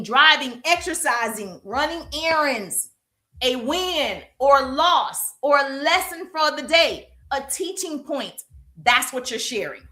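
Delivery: usual speaking voice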